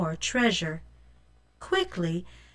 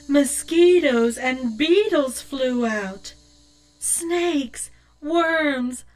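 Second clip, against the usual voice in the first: higher, louder, building horror; creepy rising excitement; 5 semitones higher, 4 dB louder, slower than usual. A narrator's reading. Narrating the bugs swarming out, building louder. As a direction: pile up the creatures, getting louder.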